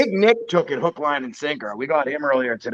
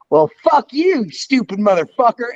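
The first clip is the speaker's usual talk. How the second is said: higher, slow, mock-furious telling-off; mock angry insult; 7 semitones higher than usual. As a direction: spit it out like a fake outraged caller. Mock insult on prank call.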